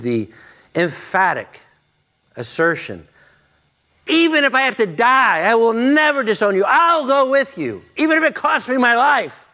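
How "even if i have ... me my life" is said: boastful vow. Acted. Peter's boastful vow acted.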